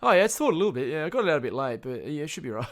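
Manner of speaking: high, loud cover-up voice; feigned casualness. Acted. Kid excusing the late-thawed meat to dad, feigned casualness. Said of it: "I'd be like".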